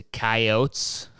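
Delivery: "coyotes" said as KAI-ohts, no ee at the end; two-syllable pronunciation. Shown as a demonstration. Storyteller naming what took the chickens, "coyotes" as KAI-ohts. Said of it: "That's not what you call 'em".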